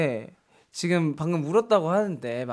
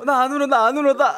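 laughing while crying; 7 semitones higher, 4 dB louder than usual. Retold or imitated. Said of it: "웃으면서".

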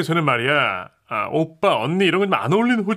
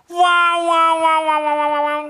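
high, loud "wa" repeated and fading; hammed-up echoing wows; exaggerated repeated wow; 14 semitones higher, 5 dB louder, much slower than usual. 줄임말에 과장된 감탄. (높게 외치며 잦아드는 와와와)

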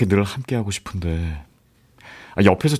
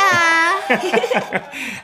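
bashful laugh. Acted. Pleased laugh at praise.

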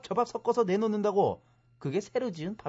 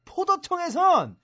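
raised, emphatic rebuttal building louder; indignant correction; 3 semitones higher, 5 dB louder than usual. Acted. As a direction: hammer the ruling home, getting louder. Heated correction of opponent's claim.

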